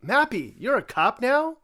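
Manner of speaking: high cartoon voice. Addresses the Mappy character.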